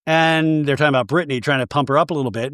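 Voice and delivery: ordinary talk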